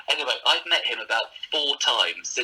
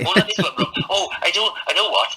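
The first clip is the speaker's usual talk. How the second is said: light, higher, louder Welsh lilt; playful Welsh accent like the comic; 7 semitones higher, 5 dB louder than usual. Retold or imitated. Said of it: "you just do little Welsh accents".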